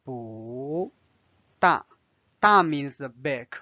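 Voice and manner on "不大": 不 and 大 said apart, 不 rising then 大 falling; slow tone demo. Shown as a demonstration. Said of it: "it's changed to the second tone".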